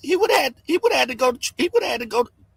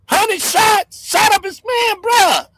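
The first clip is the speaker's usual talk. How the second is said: higher, raised, punchy voice; worked-up vlogger stressing the shooting; heated outburst; 6 semitones higher, slower than usual. Real, not acted. Rant peak.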